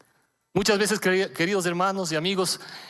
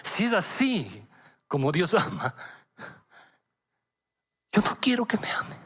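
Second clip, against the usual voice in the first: tearful, pained. Retold or imitated. Father repeating his daughter's words, tearful and pained. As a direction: retell it with pain, pausing between phrases. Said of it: "Y lo digo con lágrimas, con dolor".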